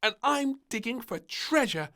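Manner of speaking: put-on high, loud little girl announcing; proud announcement. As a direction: declare it proudly. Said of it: "she announced".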